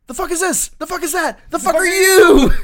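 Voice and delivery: shrill, high, loud bellow breaking into a laugh; a screeching boss, losing it; furious first sergeant. Retold or imitated. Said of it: "the first sergeant was like".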